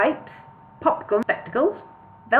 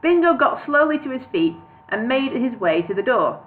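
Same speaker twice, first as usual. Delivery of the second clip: plain reading voice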